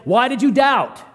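loud, high scolding question; sharp pretend reproach; harsh rebuke. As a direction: snap it like a condemning judge. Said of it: "He, he, he's not saying".

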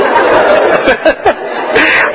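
airy laugh, half embarrassed. Real, not acted. Laughing at his own emotion before listeners.

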